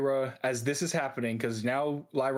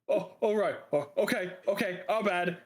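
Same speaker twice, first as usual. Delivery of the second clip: lightly voiced, higher backing-down mumblers; a touch of sheepish, higher apology; cowed laborers; 5 semitones higher than usual. Game master voices cowed laborers.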